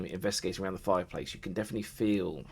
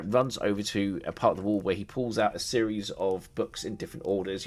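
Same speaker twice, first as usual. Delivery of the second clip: usual speaking voice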